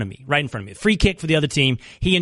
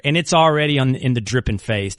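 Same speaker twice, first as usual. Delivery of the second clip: ordinary delivery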